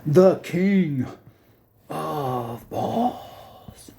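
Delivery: high then whispered grand title; grand announcer. Pretend. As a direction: announce it like royalty.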